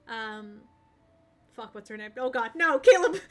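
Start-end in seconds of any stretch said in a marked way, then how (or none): 2.2-3.3 high-pitched laughing cry; laughing shriek. Real, not acted. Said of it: "That was Caleb going".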